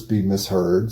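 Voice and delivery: ordinary talk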